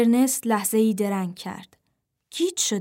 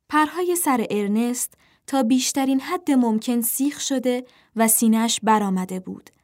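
usual speaking voice

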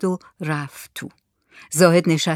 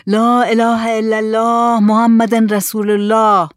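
slow, drawn-out loud cry; loud call; 3 semitones higher, 5 dB louder than usual. Acted. Saleh calls from atop the coffin to rouse the village, loud slow call. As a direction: call it out loud and long, solemnly stretched. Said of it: "با صدای بلند داد زد".

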